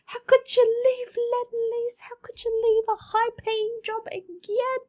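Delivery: high, loud, shrill-pitched panicked parent voice; mimicking her parents. Parents scolding her for quitting, in a high loud panicked voice. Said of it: "my parents were like".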